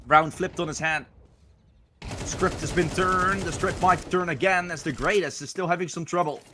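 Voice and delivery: rising, hyped live play-by-play; esports caster. Acted. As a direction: call the action, building excitement.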